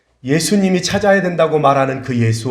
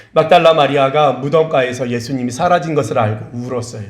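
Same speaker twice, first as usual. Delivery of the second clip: ordinary delivery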